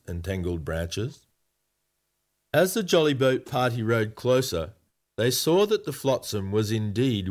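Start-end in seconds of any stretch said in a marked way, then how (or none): none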